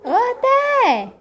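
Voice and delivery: high, loud sing-out call. Real, not acted. Calling out to Teté. (chamando alto, voz aguda)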